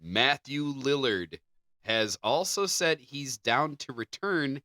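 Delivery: normal talking voice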